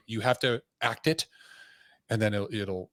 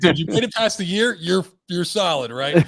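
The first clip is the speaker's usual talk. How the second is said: higher, louder bro pep talk; hyped-up buddy; 6 semitones higher, 6 dB louder than usual. Retold or imitated. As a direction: pump the rookie up like an old pal. Retelling a veteran agent reassuring.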